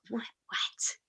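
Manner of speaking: whispered disbelief; baffled whisper. Retold or imitated. Sister reacting to her brother's logic, baffled whisper. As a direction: whisper it, as if it makes no sense.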